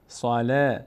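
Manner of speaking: صالح as ṣalāh with a thin, front long ā after L; light lam. Shown as a demonstration. Teacher showing lam is not heavy, light pronunciation. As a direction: keep the L in صالح thin, vowel toward æ.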